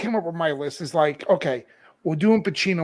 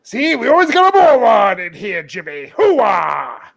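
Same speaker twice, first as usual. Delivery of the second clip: loud gung-ho soldier; Frank Slade impression; 4 semitones higher, 6 dB louder than usual. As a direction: bark it like an old army colonel. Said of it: "I do a good fucking Lieutenant Colonel".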